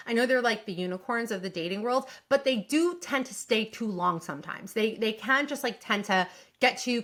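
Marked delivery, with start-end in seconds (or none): none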